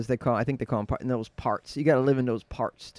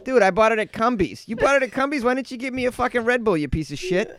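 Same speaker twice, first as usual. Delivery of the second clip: high, loud, quarrelling Boston locals; Boston accent; 8 semitones higher, 5 dB louder than usual. Shown as a demonstration. Two locals squabbling over a lottery ticket, Boston accent. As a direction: argue in a tough Boston accent. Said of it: "the Boston is".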